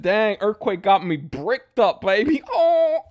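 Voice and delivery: loud, slow, high, laughing; laughing joke boast; laughing hype. Real, not acted. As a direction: crack up while hyping it.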